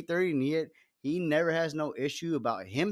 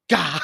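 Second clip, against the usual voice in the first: mock effort grunt; 3 semitones higher, 7 dB louder than usual. Throwing empties into the sink.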